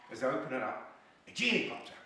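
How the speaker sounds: usual speaking voice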